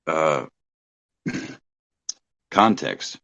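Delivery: talking through hiccups; hiccuping between words. Real, not acted. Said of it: "I have the hiccups".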